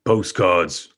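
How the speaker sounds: curt one-word answer in character; tersely, giving nothing away. Acted. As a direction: keep it short and guarded. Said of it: "why he was also rather terse".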